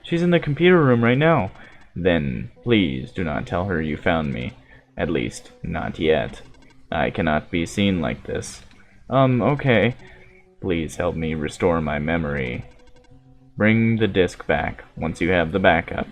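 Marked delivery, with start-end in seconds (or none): 2.0-8.6 low, hushed android, quietly probing; softly solemn machine prophet; calm robot voice. Acted. 10.6-16.1 low, measured android speaking evenly; steady, solemn machine prophet; calm robot voice. Acted.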